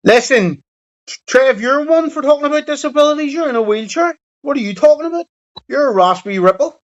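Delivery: mock-outraged high-pitched ribbing of a mate; high mocking taunt. Real, not acted. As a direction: squeal the insult up high to wind him up.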